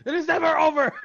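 loud, high dramatic movie line; Rambo quote. Retold or imitated. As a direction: deliver it like a film climax. Quoting a Rambo film line.

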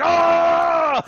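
long, high, loud "ahh" scream; drawn-out whooping yell; acted excited yell. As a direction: reenact a big shared holler. Acts out both players yelling together.

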